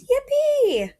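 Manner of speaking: very high, loud cheer; shout of joy. Acted. Kay cheering at news of the Mexico trip, loud shout. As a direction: whoop for joy. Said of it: "Kay shouts".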